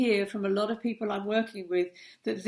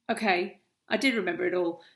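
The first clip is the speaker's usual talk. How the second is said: usual speaking voice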